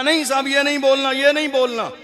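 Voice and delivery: a hint of a mocking committee member. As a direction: sneer it like a meddling official. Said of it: "आज बोलने नहीं दिया जा रहा".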